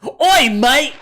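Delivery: loud, high British-Australian "Oi, mate!"; hammed-up British-Australian shout; mock accent. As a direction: blurt a cartoon British-Australian greeting. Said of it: "try to use an accent".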